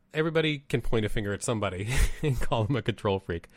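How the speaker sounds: ordinary talk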